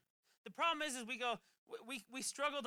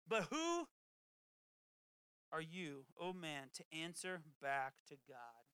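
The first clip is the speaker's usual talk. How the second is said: 1 scripture reading; 4 semitones lower than usual. Acted. Reads Romans scripture aloud.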